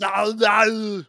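Austrian-accented Arnold impression, loud; heavy Austrian accent, Arnold style; Schwarzenegger impression. Friends mocking Arnold, Austrian-accented loud no. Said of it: "it's just the way he talks".

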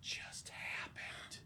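slow, breathless goblin whisper; stunned whisper. Acted. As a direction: whisper it in shock. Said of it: "all the other goblins are kinda standing around like".

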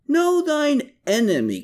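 high pitched shrill cry of Know, falling off; screeched. A narrator's reading. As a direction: Klaus shrieks his motto high up. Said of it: "Klaus screeched".

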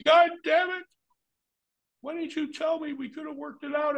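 hammed-up exasperated promoter; exasperated boss. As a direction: whine it out in frustration like the German boss. Boss slams head on table.

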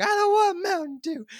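put-on very high, loud screaming child; kid tantrum scream. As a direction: throw a full bedtime tantrum. Kid freaking out.

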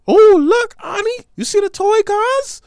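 put-on high, loud childlike cry of wonder; squealing with delight at the toy cars; loud excited child. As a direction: shout it up high like a thrilled little kid. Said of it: "I used to express loudly".

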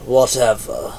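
sighed, unimpressed brush-off; dismissive. A narrator's reading. Goremu brushes off the plea for help, dismissive. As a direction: breathe out hard, then wave it away. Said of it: "rolled his eyes".

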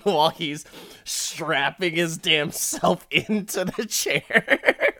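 normal talking voice

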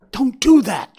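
high, loud alarmed shout. A narrator's reading. Faline shouting to stop Bambi, alarmed. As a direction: yell to stop him. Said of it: "shouted Faline".

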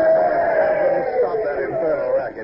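long, slow yell over a din; exasperated shout. Acted. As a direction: bellow for the noise to stop. Officer yells over noisy prisoners.

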